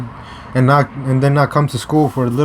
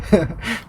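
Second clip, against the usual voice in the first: short high laugh; 8 semitones higher, 4 dB quieter than usual. Real, not acted. Laughing at his victory.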